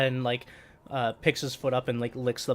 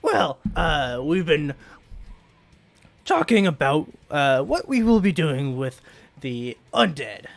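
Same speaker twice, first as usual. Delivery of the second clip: raised character voice; NPC voice; 2 semitones higher, 6 dB louder than usual. Acted. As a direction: address the group as leader. GM voices Ismali speech.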